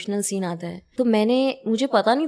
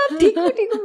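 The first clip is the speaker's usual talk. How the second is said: high, laughing "are you okay" through giggles; laughing worried check; 11 semitones higher than usual. Retold or imitated. Actress to the fallen aunt, laughing while asking if she's okay. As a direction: ask if she's fine while trying not to laugh.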